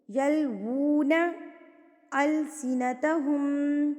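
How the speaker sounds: slow, melodic tajweed chant; slow measured recitation of the verse; Quran recitation. Acted. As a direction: recite each word drawn out.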